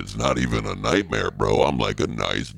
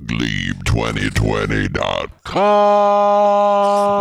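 slow drawn-out slogan read, a bit louder; 4 dB louder, much slower than usual. Acted. Candidate slowly drawls his website for the ad. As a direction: stretch the web address like a jingle.

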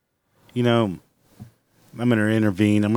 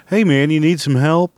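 higher, louder mock-helpful call; helpful bystander act; 6 semitones higher, 6 dB louder than usual. Onlooker offering help once the last box is stored, mock-helpful. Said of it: "and say".

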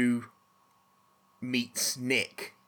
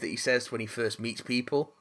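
normal talking voice

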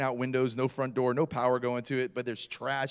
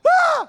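high, loud mock shriek; terrified scream; 12 dB louder than usual. Kids see a face pop through glass, mock scream. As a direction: imitate kids screaming at a sudden fright.

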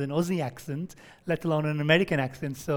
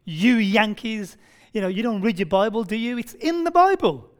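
mock angry outburst; 2 semitones higher, 6 dB louder than usual. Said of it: "really angry".

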